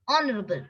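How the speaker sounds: "Honorable" pronounced on-orable; silent H. Shown as a demonstration. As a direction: start "Honorable" on the vowel. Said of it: "It is".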